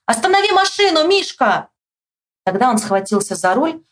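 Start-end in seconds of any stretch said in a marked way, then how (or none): 0.1-1.7 high-pitched plea across a yard; high narrated yell; shouting. A narrator's reading. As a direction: call out for help to stop. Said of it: "и закричал".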